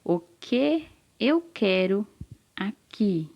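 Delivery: slow repeat with gaps between word groups; careful reading aloud. Shown as a demonstration. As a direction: read it out slowly for a pupil to follow. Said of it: "Vou repetir". (leitura lenta e pausada)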